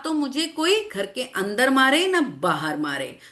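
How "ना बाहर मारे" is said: ordinary talk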